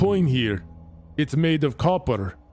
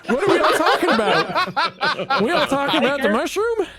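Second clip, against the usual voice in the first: higher confused mushroom voice; damp muffled mushroom voice; 10 semitones higher than usual. Acted. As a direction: go up high and muddled as the mushroom. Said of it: "What is wrong with the mushroom's voice?".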